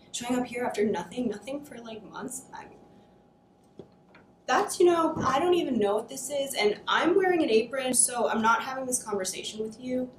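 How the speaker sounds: loud, rising, furious rant; angry outburst. Acted. Daughter blowing up at her mother who left, loud and furious. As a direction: she finally snaps and lets it all pour out, loud.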